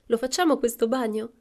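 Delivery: high, loud called-out question; calling out. A narrator's reading. Men arriving at the beach urge a swim, calling out.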